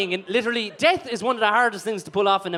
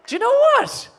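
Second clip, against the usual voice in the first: laughing surprise; 4 dB louder than usual. Real, not acted. Host reacts to the grandfather's resemblance.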